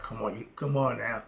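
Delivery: ordinary delivery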